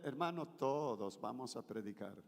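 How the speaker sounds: 1 casual low invitation. Shown as a demonstration. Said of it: "Si yo le digo a ustedes".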